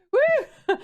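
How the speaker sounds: loud high whoop; excited cheer. Real, not acted. Cheering at the drunk joke.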